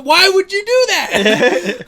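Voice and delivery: high, loud and slow, in disbelief; why, drawn out in disbelief; exasperated. Real, not acted. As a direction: throw up your hands at it. Ranting about the shield scene.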